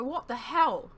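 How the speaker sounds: startled, shocked question; alarmed cry. Acted. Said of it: "Talgith cried".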